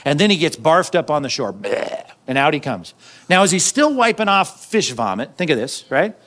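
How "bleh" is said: low "bleh" of vomiting; imitated retching. Fish barfing Jonah onto shore, comic retch. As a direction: mimic being barfed up, low and brief.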